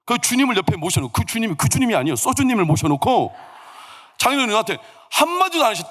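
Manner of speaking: usual speaking voice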